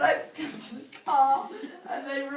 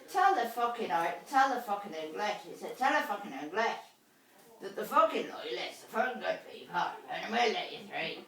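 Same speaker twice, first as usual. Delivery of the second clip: mock gruff dad; 5 semitones lower, 7 dB quieter than usual. Dad's retold gruff order.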